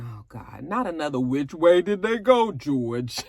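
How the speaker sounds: mock groan. Mocking the show.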